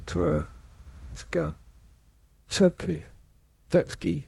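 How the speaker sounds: ordinary talk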